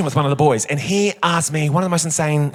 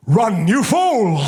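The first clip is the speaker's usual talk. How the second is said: slow, hammed-up epic warning; dramatic warning; 4 semitones higher, much slower than usual. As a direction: drag it out like a movie hero. Retold bystander's dramatic line.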